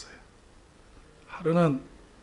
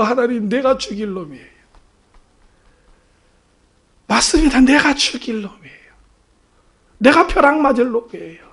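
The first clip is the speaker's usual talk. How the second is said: raised, louder penitent cry; tearful confession; 5 semitones higher, 6 dB louder than usual. Retold or imitated. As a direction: confess to God in re-enacted anguish. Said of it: "얼마나 울었는지 몰라요". (울부짖으며 회개하듯)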